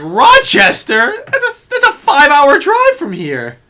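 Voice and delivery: high, loud, stretched-out disbelief; shocked disbelief. Retold or imitated. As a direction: shriek it in outrage. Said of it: "It's like".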